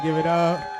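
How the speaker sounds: loud call for applause; crowd hype call. Real, not acted. Comic rallying the audience for a festival, loud hype call.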